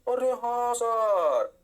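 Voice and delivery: exaggerated, drawling realisation; mock student agreement. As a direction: stretch it out slowly as the class catching on. Teacher voices students' drawn-out agreement.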